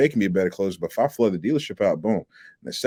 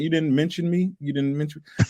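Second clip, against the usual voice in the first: higher-pitched mock-hurt questioning; retold indignant disbelief; 7 semitones higher than usual. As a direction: ask it high, as if slighted. Retold hurt reply to customer.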